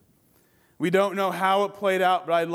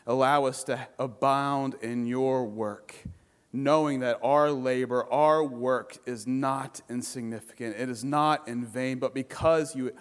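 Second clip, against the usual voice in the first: closing prayer; 7 semitones lower, 3 dB quieter than usual. Acted. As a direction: pray it softly with long pauses. Closing prayer after sermon.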